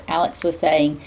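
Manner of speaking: plain reading voice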